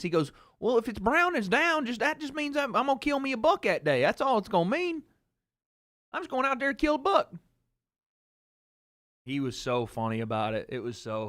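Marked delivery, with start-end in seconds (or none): 0.6-7.3 high-pitched Southern dad impression; dad's accent. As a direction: imitate dad protesting his innocence. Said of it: "That's exactly him".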